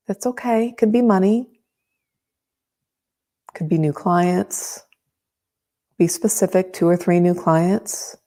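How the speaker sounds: calm, close, unhurried guiding; right in your ear. Acted. Leads a tapping meditation.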